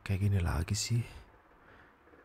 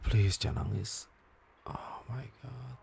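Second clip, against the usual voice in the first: slow, whispered dismay; exasperated whisper; 6 dB quieter, slower than usual. Acted. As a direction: whisper it in disbelief. Partner starts crying in public.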